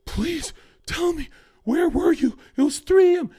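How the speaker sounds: high, loud imitation of a panicking girlfriend; mock panicked pleading. Imitating girlfriends waiting up at night, high panicked pleading.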